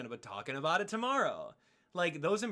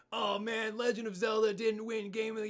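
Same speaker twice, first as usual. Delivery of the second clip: mock whiny fan; 7 semitones higher than usual. Mocking fans' complaint.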